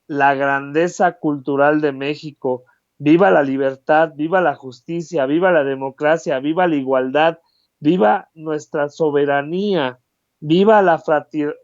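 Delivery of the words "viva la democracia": ordinary talk, nothing marked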